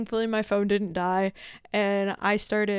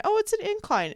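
mock surprise; 12 semitones higher than usual.

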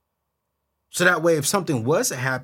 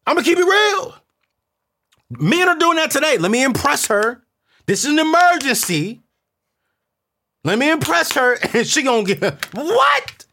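high, loud, rising hype; comic build-up; 8 dB louder than usual. Real, not acted. Confessing to the audience, loud climbing hype. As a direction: ramp it up to the punchline.

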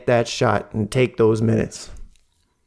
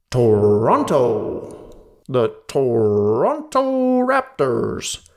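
slow American-style "Toronto", r sounded, second t dropped; American announcer accent; slower than usual. Retold or imitated. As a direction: mimic a US weatherman saying "the Toronto Raptors". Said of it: "He says it like".

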